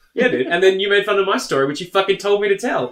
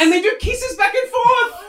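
high silly voice; slower than usual. Pretend. Mocking walrus kisses.

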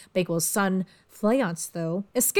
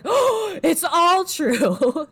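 amazed Macbeth; 9 semitones higher, 6 dB louder, much slower than usual. Acted. Macbeth learns prophecy came true.

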